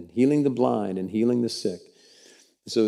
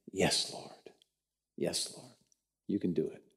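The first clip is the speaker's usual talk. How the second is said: soft, lower, near whisper; hushed reply; 7 semitones lower, 15 dB quieter than usual. Acted. Blind men answering Jesus, soft awed whisper. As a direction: answer in awe, barely voiced.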